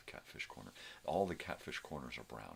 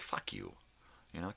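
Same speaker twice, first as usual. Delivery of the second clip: ordinary talk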